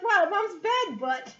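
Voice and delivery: put-on high, slow child's voice, correcting herself; awed little girl. Lila fixing her swear for her mother, high child voice. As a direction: say it like a kid dodging a swear. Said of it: "she's like".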